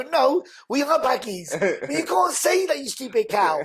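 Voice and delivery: high, drawn-out comic protest; slow, high indignant retort; mock indignation. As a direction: correct her in high-pitched mock outrage. Comic retold argument with the girl.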